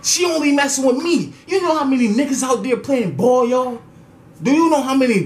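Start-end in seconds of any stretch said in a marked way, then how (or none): 0.0-3.1 high, pleading complaint with a laugh; exasperated plea. Retold or imitated. To her brother, a high exasperated plea. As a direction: beg him to rein her in. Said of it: "I'm like".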